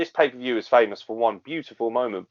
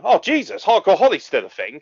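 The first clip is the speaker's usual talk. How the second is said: mock surprise; 8 semitones higher, 3 dB louder than usual. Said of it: "I'm thinking".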